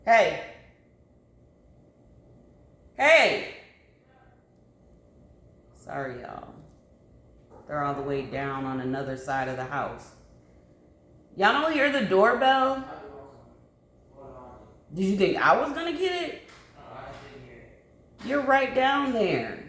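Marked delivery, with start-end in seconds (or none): 0.1-3.6 loud high calls; yelling across the house. Real, not acted. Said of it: "that yelling part". 11.4-19.6 high, loud and fast; yelling across the house. Real, not acted. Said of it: "that yelling part".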